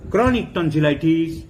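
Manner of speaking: ordinary delivery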